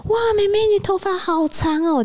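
put-on high, slow, cooing voice of a young hairdresser; high sugary hairdresser voice; sweet hairdresser.

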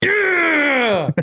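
high joyful yell; excited shout. Real, not acted. Celebrating Jack pick.

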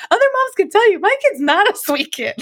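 high-pitched imitation, insisting; exasperated mom. Luca's mom insisting about her son, exasperated. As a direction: re-enact a mom adamant about her own kid.

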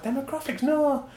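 high puzzled question, then a flat high no; baffled. Retold or imitated. Officer puzzled by the reporter's question. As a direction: sound clueless at a big word. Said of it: "I was like".

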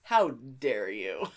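slow, low hammed-up indignation; mock outrage. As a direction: drag it out in fake offense. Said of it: "I'm like".

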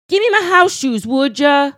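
put-on holler across the house; calling out in skit. Performing the skit line after checking it.

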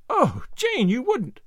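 high, shocked cry of disbelief. Acted. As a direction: gasp it out in dismay. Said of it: "cried Anne, shocked".

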